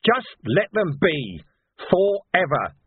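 heated speech slowing to an emphatic close; angry outburst. A narrator's reading. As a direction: rail indignantly, punching the key words. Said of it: "as if there had been no outburst".

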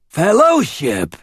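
high, slow rasping snake cry; rasped. A narrator's reading. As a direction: rasp it out like a snake man. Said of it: "he rasped".

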